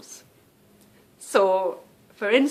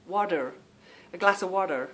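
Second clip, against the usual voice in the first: put-on American accent. Demonstrating for viewers, American "water" as "wah-der". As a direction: imitate an American asking for "water". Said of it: "if I can pronounce this".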